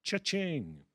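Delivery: high cash register ding sound; cash register cha-ching. Acted. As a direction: sing out cha-ching like a till. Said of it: "make the sound of a cash register going".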